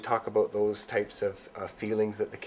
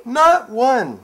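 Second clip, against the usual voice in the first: high, slow, loud lament; dramatic lament; 12 dB louder than usual. Acted. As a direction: wail it like a heartbroken prince. Prince despair.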